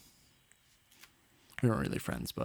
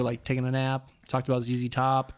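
ordinary delivery

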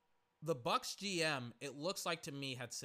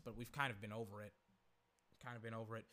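ordinary talk, nothing marked

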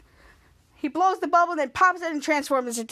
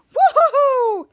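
high, loud whoop; gleeful whoop; 9 semitones higher, 7 dB louder than usual. Retold or imitated. Time jerker celebrates his trash throw, loud whoop. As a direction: whoop in triumph.

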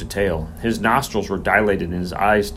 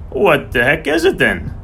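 higher, impatient demand; irritated challenge; 7 semitones higher, 4 dB louder than usual. A narrator's reading. Groom demands a diagnosis from the vet, irritated. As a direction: throw the question back in irritation.

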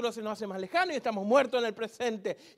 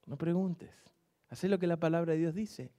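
ordinary delivery

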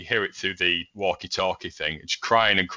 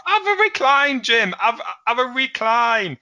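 put-on much higher, louder, gushing old man; over-enthusiastic dad; 5 dB louder than usual. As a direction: push the recliner on Jim like it's a miracle. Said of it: "Seriously over-enthusiastic".

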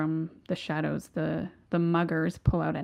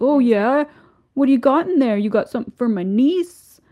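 higher, louder mocking thug; bully taunt; 6 semitones higher, 7 dB louder than usual. Mugger taunting Paul about the presents, loud jeering. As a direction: push him around with a jeering voice. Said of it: "he's like".

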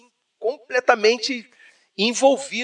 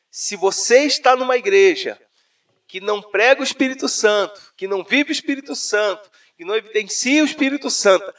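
preaching; 3 semitones higher, 6 dB louder than usual. Retold or imitated. Telling his friend Raul about the sermon, imitating the preacher's fiery peak. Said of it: "Aí ele falou assim".

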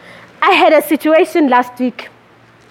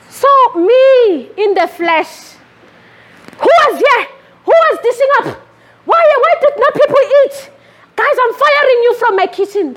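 mock kitchen outburst; 8 semitones higher than usual. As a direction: replay her own snapping at the kitchen crew. Preacher acts out her kitchen outburst.

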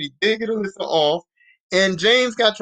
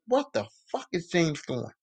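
low, disgusted mutter under her breath; 3 semitones lower, 8 dB quieter than usual. Retold or imitated. Alyssa spotting James stalking her, a disgusted mutter. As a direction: say it to yourself, appalled. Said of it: "She's like".

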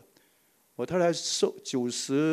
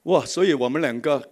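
ordinary talk

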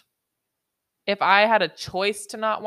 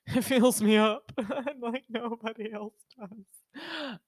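laughing; 6 semitones higher, slower than usual. Pretend. Joking sexy voice.

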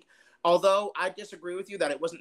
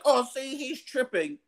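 mocking critics; 7 semitones higher than usual. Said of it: "and they're like".

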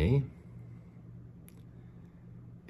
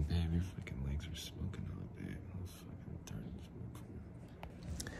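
low mumbly whisper, leering; low, mumbled creep whisper; 7 semitones lower, 14 dB quieter than usual. Pretend. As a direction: lean in and breathe it like a creep. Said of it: "It's a new voice I'm working on".

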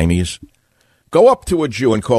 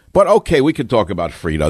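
sarcastic. Real, not acted. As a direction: throw it off sarcastically. Sarcastic pivot.

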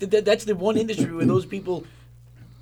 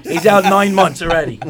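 laughing; 11 dB louder than usual. Real, not acted. Joking, laughing through line.

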